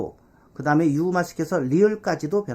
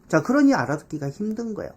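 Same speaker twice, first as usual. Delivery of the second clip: ordinary delivery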